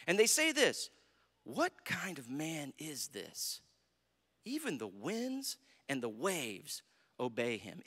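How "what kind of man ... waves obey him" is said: low, slow, hushed wonder; hushed awe. Acted. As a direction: ask it softly and slowly, awestruck. Disciples' awed question after the calm.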